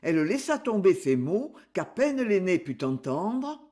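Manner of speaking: plain reading voice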